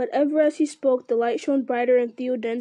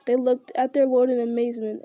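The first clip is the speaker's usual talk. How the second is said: plain reading voice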